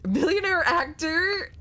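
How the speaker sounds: high, loud, slow correction; questioning. Real, not acted. Streamer correcting a slip, loud and high. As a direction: query the word loudly.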